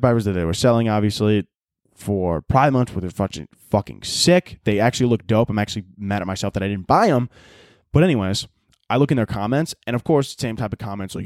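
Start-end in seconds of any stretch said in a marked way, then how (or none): none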